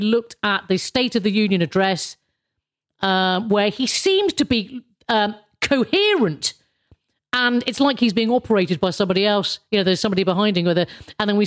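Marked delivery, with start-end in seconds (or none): none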